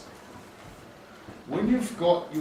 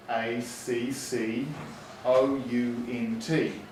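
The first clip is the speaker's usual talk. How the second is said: letters spelled out slowly; slow school dictation; 2 semitones lower, much slower than usual. Shown as a demonstration. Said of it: "teacher demos spelling aloud".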